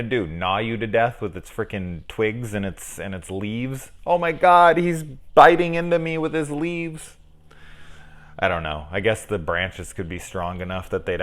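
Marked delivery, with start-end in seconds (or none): 4.1-7.2 mock panic. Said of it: "mock victim of hedge".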